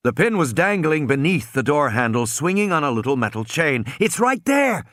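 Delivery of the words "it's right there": urgent shout. A narrator's reading. Tim urgently directing.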